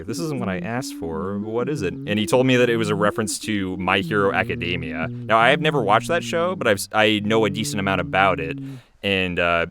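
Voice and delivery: ordinary delivery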